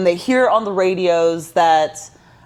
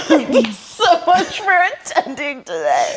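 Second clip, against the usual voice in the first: mock host; 5 semitones higher, slower than usual. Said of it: "mock crew meeting".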